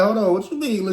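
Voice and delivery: squeaky puzzled question; high mock confusion. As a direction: play dumb, high and loud. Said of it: "I'm like".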